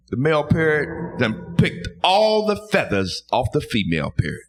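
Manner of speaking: normal talking voice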